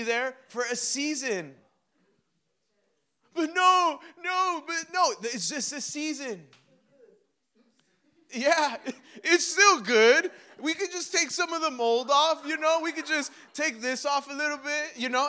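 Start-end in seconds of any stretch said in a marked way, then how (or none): 3.4-6.5 high, loud protesting no's, easing off; mock protest. Churchgoer refusing to let a season end, mock protest. 8.3-15.3 high, loud, over-eager reassurance; mock insistence. Person excusing mouldy milk to God, mock insistence. Acting someone clinging to expired milk.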